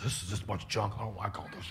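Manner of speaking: low, soft mock grumble; a lightly voiced complaining churchgoer; grumbling complainer. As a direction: sulk about the changes under your breath. Grumbling congregants resisting change.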